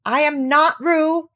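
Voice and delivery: slow, high, loud insistence; small creature's loud objection; loud protest. A narrator's reading. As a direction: declare it loudly, word by word. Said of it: "said Piglet loudly".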